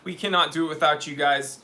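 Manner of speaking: usual speaking voice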